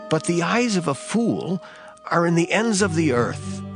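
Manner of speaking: normal talking voice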